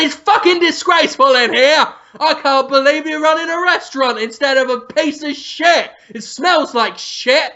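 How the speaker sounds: high, indignant ranting; hammed-up angry chef tirade; mock chef outrage. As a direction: tear into the restaurant in mock fury. Acted Ramsay rant at restaurant.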